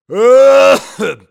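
very loud, high hacking fit; old man's cough. Acted. Old husband interrupts himself before his wife, violent cough. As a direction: burst into a long acted coughing fit.

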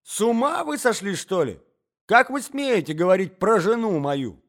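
a hint of an outraged husband; angry old man. A narrator's reading. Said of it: "закричал старик".

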